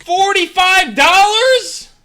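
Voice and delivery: high, loud, drawn-out yell of disbelief; outraged shout. Real, not acted. Streamer balking at forty-five dollars, outraged shout. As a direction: shout the price in slow outrage. Shocked at the seller's price.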